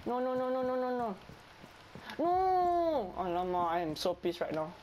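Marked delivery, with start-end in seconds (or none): 0.0-1.2 rapid high string of nos; frantic nos. Real, not acted. Teammate stealing last kill. 2.2-3.1 very high, loud wail of no; anguished yell. Real, not acted. Kill stolen.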